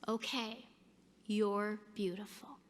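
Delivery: soft and sincere; tender. Retold or imitated. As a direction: hush it gently like a tender compliment. Buttoning coat, tender compliment.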